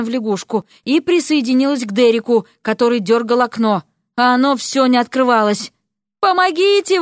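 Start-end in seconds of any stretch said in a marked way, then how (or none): 6.2-7.0 high-pitched cry for help; narrator's acted panicked yell; wailing for help. A narrator's reading. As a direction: wail it out, much higher. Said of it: "вопил он".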